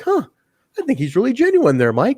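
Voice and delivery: high mock commentator voice; imitating Don West. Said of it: "Don's like".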